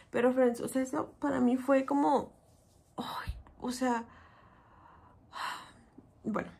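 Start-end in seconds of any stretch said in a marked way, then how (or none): 3.0-6.5 breathy whisper of dismay; slow stunned whisper. Real, not acted. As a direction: whisper it, collapsing inside. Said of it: "yo estaba colapsando por dentro".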